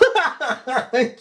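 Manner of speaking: high, real laughing fit; helpless amused laugh. Real, not acted. Laughing at the poor fella hurting himself, giggling. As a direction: crack up at the memory. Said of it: "it makes me laugh even now".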